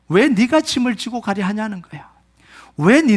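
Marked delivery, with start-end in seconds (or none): none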